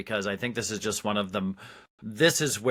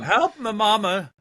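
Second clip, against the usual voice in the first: mock Italian plea; 9 semitones higher, 6 dB louder than usual. Co-host echoes the mock plea.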